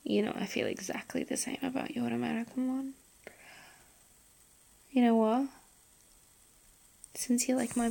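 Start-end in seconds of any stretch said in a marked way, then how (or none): 0.1-2.9 soft intimate whisper; breathy close-mic whisper. Acted. Whispered intimate roleplay throughout.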